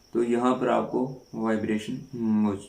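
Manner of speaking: normal talking voice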